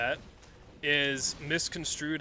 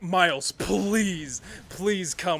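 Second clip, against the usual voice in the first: mock pleading; 3 semitones higher, 5 dB louder than usual. Imitating promoters begging a musician to play, pleading. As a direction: act out bookers begging, starting loud and easing off. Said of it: "then they're like".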